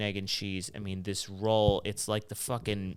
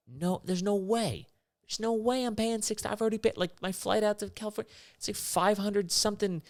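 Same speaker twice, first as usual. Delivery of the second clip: much higher, strained voice in mock fury; indignant outraged rant; 12 semitones higher than usual. Real, not acted. Comic raging at six-dollar airport water, high and outraged. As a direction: rant like it's a personal insult.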